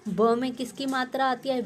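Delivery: usual speaking voice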